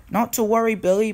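high-pitched reassurance; lifted storybook voice, helpful; reassuring Thomas. A narrator's reading. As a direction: comfort a stranded friend, pitched high. Thomas reassures stranded Billy.

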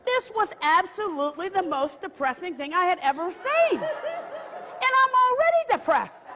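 high-pitched comic dismay; hammed-up, dragged-out lament; exasperated complaint. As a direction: wail it in mock despair. Comic rant about dismal arrival.

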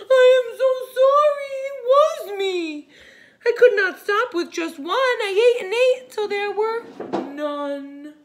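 high, loud, fast sobbing-laughing confession; sorry toad. Acted. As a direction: blubber out the apology as the toad. Grumpy Toad confesses, voiced.

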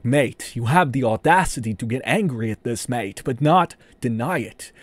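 put-on Australian accent, sneering "mate"; mock Australian. Retelling the Sydney guy's reply, Australian accent. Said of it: "some old fat guy from Sydney, Australia".

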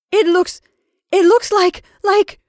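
high, loud, halting with horror; stammer, unable to finish; horrified stammer. Acted. Mrs Brigham describing the shadow, horrified stammer. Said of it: "stammered Missus Brigham in a tone of intense horror".

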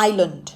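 "island" as eye-lund, no S; correct pronunciation. Shown as a demonstration. Teacher repeating after explaining the ending, correct "island" as eye-lund. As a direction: end "island" on a neutral lund. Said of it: "ออกเสียงข้างหลังเป็นเลิ่น".

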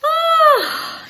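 big high yawning "oh"; imitated yawn. Yawns from the house at morning, mock yawn. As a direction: let out a drawn, high waking-up yawn. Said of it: "I hear yawns".

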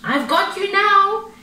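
high cub's yell; gleeful little panda shout; playful yell. A narrator's reading. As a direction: yell it high, mid-wrestle. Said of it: "he yelled as they wrestled".

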